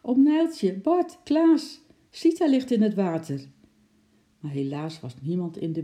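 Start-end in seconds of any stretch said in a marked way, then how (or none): 0.0-3.4 high, loud calling out in alarm; urgent, raised-pitch shout; shouting for help. A narrator's reading. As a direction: call across a distance to raise the alarm. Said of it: "schreeuwde Lotje zo hard als ze kon".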